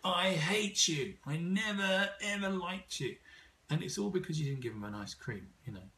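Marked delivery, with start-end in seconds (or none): 0.0-3.2 put-on slow, higher wailing child's complaint; mock child tantrum. Child yelling at parent over ice cream, slow and higher. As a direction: play a sulking kid denied ice cream. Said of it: "kids say to you things like".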